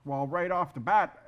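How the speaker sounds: ordinary talk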